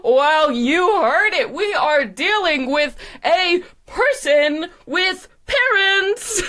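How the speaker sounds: slow, high, mock-grand buildup; hyped show announcer. Announcer marvelling at a person with parents, mock-amazed. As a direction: draw it out, climbing to a peak.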